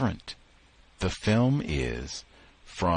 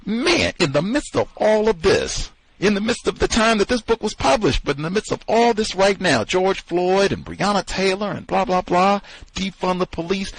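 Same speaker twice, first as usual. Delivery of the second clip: much higher, pitched-up indignant rant; impassioned outburst; 12 semitones higher than usual. Real, not acted. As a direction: let the outrage carry the voice up high. Outraged rant about police dog.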